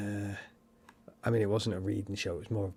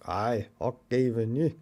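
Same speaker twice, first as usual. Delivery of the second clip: put-on Scottish accent; 4 dB louder than usual. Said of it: "I love the Scottish accent".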